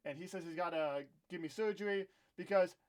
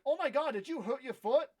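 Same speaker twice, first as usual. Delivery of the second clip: mocking commenters; 6 semitones higher, 6 dB louder than usual. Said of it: "people asking me, like".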